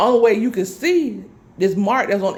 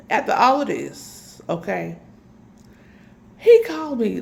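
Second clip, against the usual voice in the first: usual speaking voice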